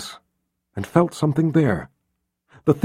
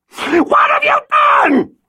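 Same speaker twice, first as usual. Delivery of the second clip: high shrieking scream, slow; hysterical scream; 4 dB louder, slower than usual. Acted. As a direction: scream it in horror. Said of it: "she cried out from the darkness".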